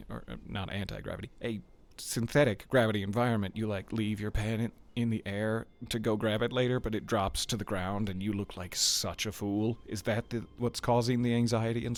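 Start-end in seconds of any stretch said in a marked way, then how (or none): none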